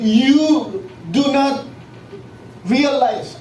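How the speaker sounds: "You" drawn out slow and high; a bold, rebuking "You do not"; emphatic you. Retold or imitated. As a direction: lean hard on "You". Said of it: "When Peter said".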